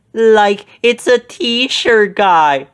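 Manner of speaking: high, loud, stretched-out cartoon exclamation; Shaggy exclaiming. A narrator's reading. Shaggy reacts as the ghost is unmasked, high astonished exclamation. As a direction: drawl it out in shock like Shaggy. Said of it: "Shaggy exclaimed".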